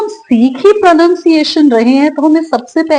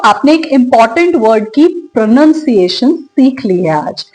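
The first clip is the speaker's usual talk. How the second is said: usual speaking voice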